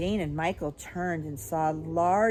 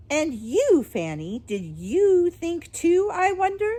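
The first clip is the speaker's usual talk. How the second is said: high, loud, swooping interrogation; high little voice; 11 semitones higher, 8 dB louder than usual. A narrator's reading. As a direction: turn menacingly on the other daughter. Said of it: "The high little voice".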